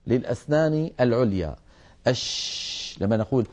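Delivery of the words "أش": "أش" with a long, diffuse sh; drawn-out hushing "أش"; spreading sheen. Shown as a demonstration. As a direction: spread the sh of "أش" through the mouth and hold it. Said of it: "انتشار لصوت الشين في أرجاء الفم".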